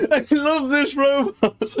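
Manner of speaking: high, slow, laugh-squeezed delight; helpless, high-pitched amusement; delighted laughter. Real, not acted. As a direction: squeeze the words out through real laughter.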